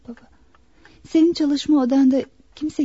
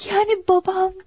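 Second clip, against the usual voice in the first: high, breathy dread; falter, then rush the question fearfully; frightened; 4 semitones higher than usual. Acted. Daughter asks the doctor if father will die, frightened.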